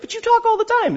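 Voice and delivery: high, mock-indignant father-in-law; imitating father-in-law. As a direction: squeak it like the grumpy father-in-law. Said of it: "He's like".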